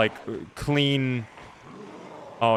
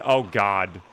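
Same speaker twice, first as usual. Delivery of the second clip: ordinary talk, nothing marked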